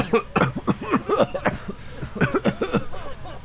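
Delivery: long pretend coughing fit; faked coughing. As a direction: keep hacking to sell the illness. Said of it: "We just gotta cough".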